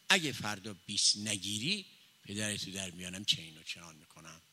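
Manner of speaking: usual speaking voice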